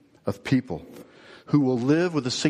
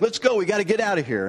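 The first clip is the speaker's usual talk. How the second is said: high, raised call over the shoulder; retold yell; 8 semitones higher than usual. Girl running past yells back to her accomplice, high mock yell. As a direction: call back urgently, pitched up. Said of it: "She yells back".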